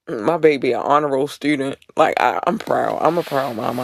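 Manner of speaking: usual speaking voice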